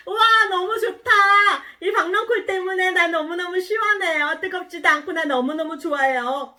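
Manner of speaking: high, loud squeal of delight easing slightly; acted childlike glee; overjoyed. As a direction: gush happily like a storybook character. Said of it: "이렇게 너무너무 기뻐했어요".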